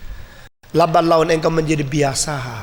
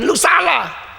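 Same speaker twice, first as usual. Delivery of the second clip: high, sharp rebuke; scolding shout; 9 semitones higher, much slower than usual. Retold or imitated. Adult scolding a child, a sharp high shout. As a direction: bark the blame at a child. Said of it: "Anak kecil kalau dimarahin".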